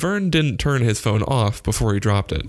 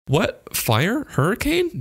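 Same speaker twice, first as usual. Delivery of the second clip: each question climbing higher in alarm; rising, anxious guesses; 11 semitones higher, slower than usual. Acted. Boy alarmed by hang-up.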